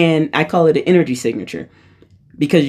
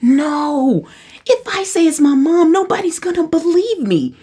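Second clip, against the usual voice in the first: dismayed; 13 semitones higher than usual. Retold or imitated. Retold worry nobody will believe her.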